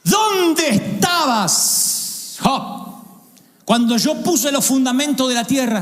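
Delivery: high, slow booming divine question; voice of God. Acted. God questioning Job, high and booming. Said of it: "Lo voy a leer tal cual".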